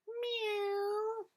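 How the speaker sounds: high, drawn-out meow; cat meow. Acted. Storyteller meowing high. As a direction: mew like a cat.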